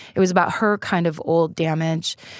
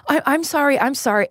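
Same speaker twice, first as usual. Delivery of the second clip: mildly startled, higher-starting apology; imitating scared apology; 6 semitones higher than usual. Daughter retelling her scared mother's apology, mildly frightened. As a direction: lightly mimic a frightened apology. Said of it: "and then I scared her".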